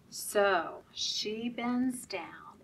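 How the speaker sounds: ordinary delivery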